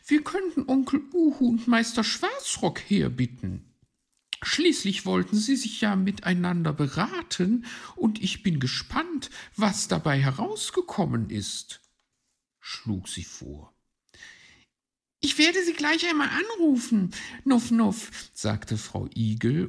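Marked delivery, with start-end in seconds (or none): 0.1-11.8 high, loud lady magpie voice; keen high-pitched magpie; suggesting magpie. Acted. 15.2-18.1 very high, fast, loud hedgehog voice; high little hedgehog with a snuffle word; helpful hedgehog. Acted. Hedgehog offers to phone.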